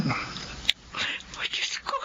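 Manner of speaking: breathless whisper of dismay; shocked whisper. Acted. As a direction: barely get the words out in shock. Hears the child needs surgery. (pabulong na pagkabigla)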